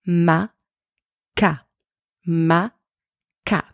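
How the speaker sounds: "ma, ka" spaced out with pauses; syllables one by one. Shown as a demonstration. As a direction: model "ma" then "ka" slowly, each on its own. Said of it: "So up to here".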